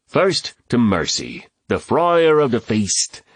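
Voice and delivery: slow, rising ceremonial call; toasting. A narrator's reading. Host honors the cook, slow high toast. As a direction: draw it out and raise the pitch. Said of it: "Reuben toasted".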